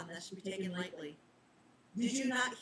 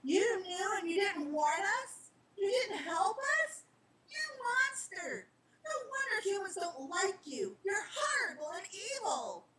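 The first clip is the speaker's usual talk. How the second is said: put-on girl raging at the reaper, voice straining high; furious accusation; 11 semitones higher than usual. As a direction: hurl each accusation at him.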